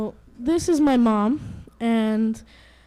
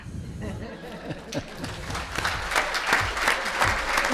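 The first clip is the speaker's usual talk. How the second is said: real breathy laugh; 4 dB quieter than usual. Real, not acted. Reader laughs after finishing.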